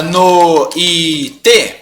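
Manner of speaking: "no e te" in three slow, separate beats; wrong syllable split. Acted. Student asking about a wrong division, "no e te" in three beats. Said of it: "Não é assim que separa".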